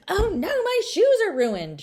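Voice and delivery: high, loud dismayed yell; girl in the mud yelling in dismay. A narrator's reading. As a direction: wail about the ruined dress. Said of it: "she yelled".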